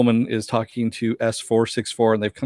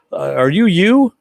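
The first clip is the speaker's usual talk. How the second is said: suspicious question; 6 semitones higher, 5 dB louder, slower than usual. Retold or imitated. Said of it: "He's like".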